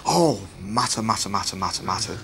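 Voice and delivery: muttering like a complaining caller; gossiping mutter. Retold or imitated. Mimicking church gossips on the phone, mock muttering. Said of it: "When the phones start going".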